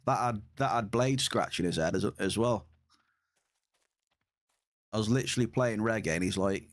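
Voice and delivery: usual speaking voice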